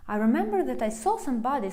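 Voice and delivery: ordinary talk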